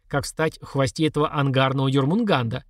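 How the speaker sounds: plain reading voice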